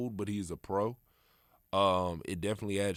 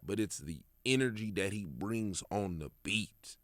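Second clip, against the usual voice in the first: ordinary talk, nothing marked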